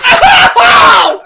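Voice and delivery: long, high, loud wail; reenacted losing-it scream. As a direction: howl "oh" as if losing it. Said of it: "I just lose it".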